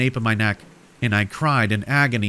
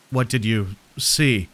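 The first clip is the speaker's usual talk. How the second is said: panting. A narrator's reading. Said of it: "Frank panted".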